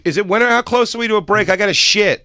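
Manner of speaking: high, loud, put-on voice; mock actress outburst. Actress to crew mid-scene, wanting a break, loud and high mock outburst. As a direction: call out over the whole set.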